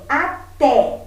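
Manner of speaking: "até" split, with a punched "té"; stress on last syllable. Shown as a demonstration. Teacher giving an example, "até" with strong last syllable "té". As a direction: hit the "té" of "até" firmly. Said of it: "a última sílaba é a mais forte".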